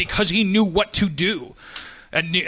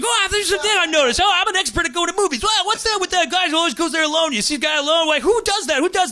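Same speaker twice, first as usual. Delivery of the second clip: high, fast, know-it-all babble; mock clueless teen; 11 semitones higher, faster than usual. Teen [name] mocking a man alone in the theater, high and fast. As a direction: squeal it like an obnoxious fourteen-year-old.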